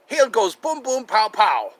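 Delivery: high, loud cartoon voice; Laotian neighbor impression. Doing the cartoon guy from Laos, high loud impression. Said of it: "I do that guy from Laos".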